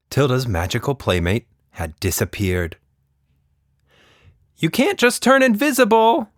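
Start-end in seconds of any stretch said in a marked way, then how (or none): 4.6-6.3 put-on high, louder child's shout. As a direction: call out to a hidden playmate. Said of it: "she shouted".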